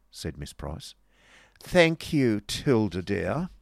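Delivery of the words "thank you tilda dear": high start, slow stately finish; lofty thanks, drawing out the endearment; majestic retort. Acted. Miss Squeers or friend answers at cards, majestically. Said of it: "retorted Miss Price majestically".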